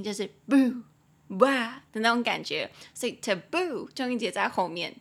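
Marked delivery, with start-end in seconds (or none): 0.5-0.8 boo said loud like a scare; mock spooky boo; scary boo. Shown as a demonstration. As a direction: make boo a little fright. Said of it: "boo是有点吓人的那个音".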